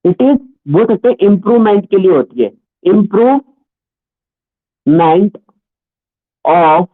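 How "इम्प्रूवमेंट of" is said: very slow, broken into parts; dictation pace. Real, not acted. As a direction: dictate it slowly, pausing between the pieces. Teacher dictating notes to class. (धीरे-धीरे लिखवाते हुए)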